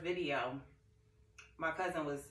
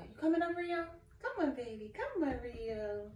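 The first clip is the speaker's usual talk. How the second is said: high, sing-song coaxing call; coaxing pet call; 11 semitones higher than usual. Real, not acted. Calling her pet Rio up onto the couch, high coaxing. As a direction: coax the pet over sweetly.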